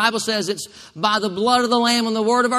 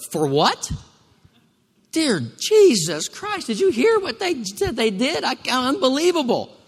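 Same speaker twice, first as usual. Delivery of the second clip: mock shocked God; 7 semitones higher, 3 dB louder than usual. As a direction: play God as a shocked busybody. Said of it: "He's not ever gonna go".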